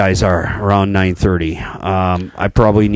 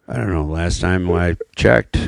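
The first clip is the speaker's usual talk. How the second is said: normal talking voice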